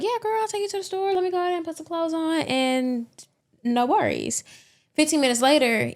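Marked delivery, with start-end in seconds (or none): 0.0-4.4 much higher, quick and chirpy; high girly neighbor voice. Retold or imitated. Neighbor agreeing to drive Yvette to the store, high and fast. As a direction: play the obliging neighbor, pitched way up. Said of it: "And Tanya was like".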